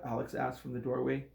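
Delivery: ordinary delivery, nothing marked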